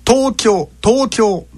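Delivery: "東京" pushed up high after the first sound and held; teacher's emphatic, high "東京"; exaggerated accent demo. Shown as a demonstration. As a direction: overdo the high, level pitch of "東京". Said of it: "ちょっと極端にやってますよ".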